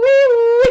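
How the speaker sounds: loud high siren wail; siren wee-woo. Retold or imitated. Retail brain sounding the alarm with a loud siren wee-woo. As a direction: belt it like an alarm going off. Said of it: "my retail brain, like, is going wee-woo".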